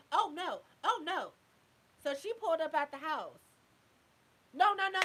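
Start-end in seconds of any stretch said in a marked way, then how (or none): 0.1-1.3 louder, higher mock dismay; mock alarm. Narrator reacting to the fight offer, mock alarm. 4.5-5.0 loud, high no no no; correcting herself. Real, not acted. Storyteller catching her own mistake, loud protest. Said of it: "That's not how it went".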